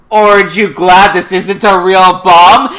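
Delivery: loud, drawn-out punchline; dramatic mock reveal. Lelouch taunting as he gets closer, hammed-up dramatic reveal. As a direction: deliver a cheesy pun grandly and slowly. Mock villain quote in recap.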